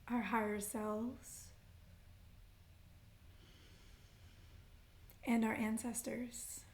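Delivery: unhurried meditation guide with long pauses; guided meditation. Acted. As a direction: lead them gently into breath and intention, phrase by phrase. Leads grounding breaths and intention setting.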